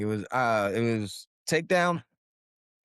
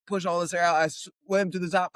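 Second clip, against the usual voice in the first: normal talking voice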